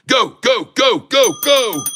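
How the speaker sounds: high, urgent chant of excitement; excited cheering. Real, not acted. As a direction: shout it on like a racing fan. Cheering on a rising stock.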